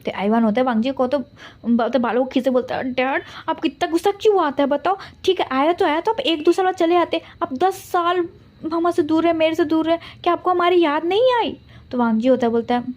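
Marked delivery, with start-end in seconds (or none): 2.9-11.6 put-on higher, childlike reproach; a little boy scolding his dad for staying away; child's voice. Son reproaches the absent father. (ऊँची बच्चों जैसी आवाज़ में शिकायत)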